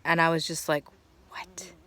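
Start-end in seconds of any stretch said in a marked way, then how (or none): 1.3-1.7 whispered "What?" in disbelief; whispering her own speechless reaction; stunned whisper. Retold or imitated. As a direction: breathe it out, amazed.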